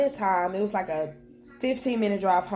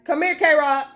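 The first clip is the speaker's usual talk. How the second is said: louder, higher call to a little one; 9 semitones higher, 6 dB louder than usual. Real, not acted. Mother calling her toddler to come to her, loud coaxing call.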